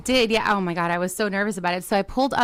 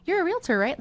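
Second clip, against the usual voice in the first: stranger asking; 10 semitones higher than usual. Retold or imitated.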